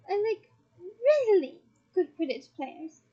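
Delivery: "really" drawn out, high and loud; smiling correction. A narrator's reading. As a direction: correct them with a smile, stretching "really". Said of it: "corrected her, still smiling".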